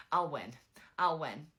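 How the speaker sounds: imitating his loud, punchy boast; mock defiance. Retold defiant threat in quarrel.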